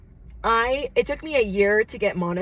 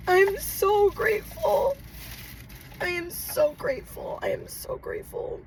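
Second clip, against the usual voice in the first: higher, breaking into tears; real crying thanks; 11 semitones higher, 3 dB quieter than usual. Real, not acted. Thanking longtime fans for the award, crying. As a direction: thank them as you cry. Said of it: "let me not get my tears on it".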